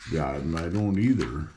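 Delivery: ordinary delivery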